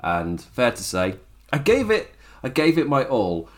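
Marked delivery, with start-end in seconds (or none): none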